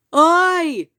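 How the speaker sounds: loud, high shout of aye; shouted vote. Acted. Moose shouts his lone vote, loud and high. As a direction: shout your vote with all you have. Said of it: "shouted Thidwick".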